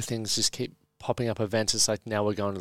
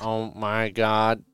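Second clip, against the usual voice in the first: slow, flat computer voice; robot voice; much slower than usual. Pretend. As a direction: say it like a machine. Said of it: "Do you like my robot voice?".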